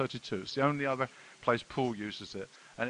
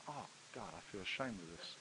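much softer, hushed confession; embarrassed murmur; 6 semitones lower, 18 dB quieter than usual. Retold or imitated. Preacher recalling his shame at the shirt, much softer murmur. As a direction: say it under your breath, ashamed. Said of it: "retold inner shame".

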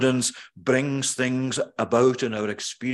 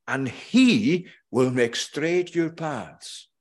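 and HE will make straight your paths, with "he" punched and the line slowed; slow preacher's emphasis falling on "he"; stress on HE; 2 semitones higher, much slower than usual. Shown as a demonstration. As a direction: lean hard on "he" in a slow, weighty reading. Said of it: "a forceful emphasis on that word, he".

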